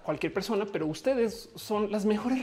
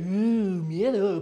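spooky voice; 5 dB louder than usual. Retold or imitated. Mimicking scary documentary music.

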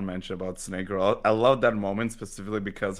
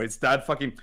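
normal talking voice

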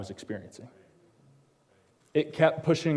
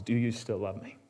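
soft voice of God; 4 semitones lower, 9 dB quieter than usual. Retold or imitated. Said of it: "God's response was".

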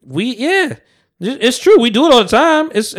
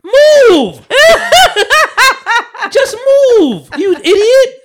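high, loud yell breaking into laughter; laughing mock yell; 6 dB louder, much slower than usual. To a lady who keeps getting tickets, high laughing yell. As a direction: yell it at her while cracking up. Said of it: "I'm like".

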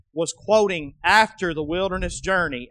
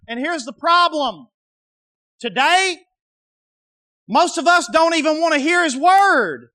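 loud, high preaching; fervent preaching, pushing hard; 6 semitones higher, 5 dB louder than usual. Acted. Preaching at full force.

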